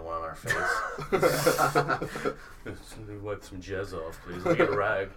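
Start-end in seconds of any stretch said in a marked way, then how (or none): 2.8-5.1 mock British. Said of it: "they actually call it spunk over there".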